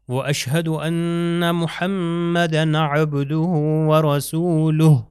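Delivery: وَرَسُولُهُ ending on a clear ہ with ع in عَبْدُهُ; careful Arabic testimony recitation. Shown as a demonstration. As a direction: recite steadily, sound the ع and the final ہ. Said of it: "آخر میں ہے".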